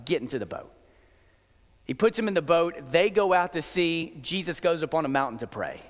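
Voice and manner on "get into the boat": calm firm order. Acted. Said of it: "And Jesus is like".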